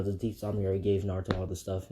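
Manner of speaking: plain reading voice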